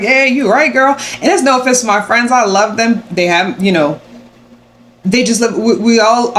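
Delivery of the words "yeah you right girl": agreeing friend. Retold or imitated. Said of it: "They're like".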